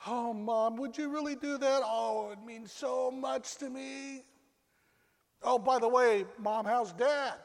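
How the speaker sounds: put-on high, relieved young mother, slow on the thanks; grateful young mom. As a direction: melt with relief at mom's offer. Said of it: "she says".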